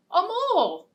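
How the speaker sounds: ordinary delivery, nothing marked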